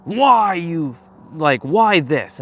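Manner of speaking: mock exasperated yelling at the sky; replaying an angry outburst in the car; retold yell.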